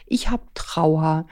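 slow, hushed whisper of grief; child's inner sadness, whispered slowly. Acted. Voicing the child's inner feeling.

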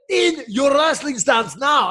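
high, slow mock yell in a Russian accent; Russian accent, shouted correction; yelling Russian coach. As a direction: bark the order like a furious Russian coach. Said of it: "heavy Russian accent".

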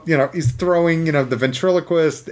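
ordinary talk, nothing marked

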